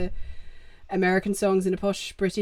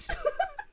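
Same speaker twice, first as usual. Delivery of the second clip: high giggle; 6 dB quieter than usual. Real, not acted. Laughs about Luke's setup.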